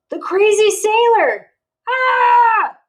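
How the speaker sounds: high loud scared scream; screaming in fright. Acted. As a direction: shriek as if the sailor is there. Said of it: "everyone screamed".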